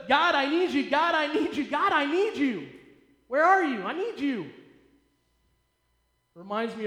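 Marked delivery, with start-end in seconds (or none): 0.1-4.5 loud repeated plea to God; desperate pleading. Retold or imitated. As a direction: cry out begging, louder.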